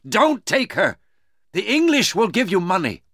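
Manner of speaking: high-pitched urgent plea; urgent shouting. Acted. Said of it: "shouted Duncan".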